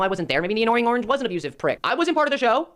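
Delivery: ordinary talk, nothing marked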